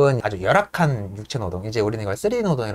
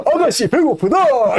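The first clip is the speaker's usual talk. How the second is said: slow, much higher hammed-up cry; mock Yank shout; 10 semitones higher, 4 dB louder, slower than usual. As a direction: call out that the lady is hungry. Yank shouting about the furnace. (느리고 높게 외치는 양크 흉내)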